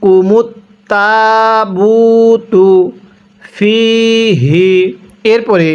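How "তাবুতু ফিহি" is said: drawn-out chanted recitation of তাবুতু ফিহি; slow Quran recitation. Shown as a demonstration. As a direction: recite তাবুতু ফিহি slowly with long vowels. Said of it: "কিভাবে পড়তে হবে".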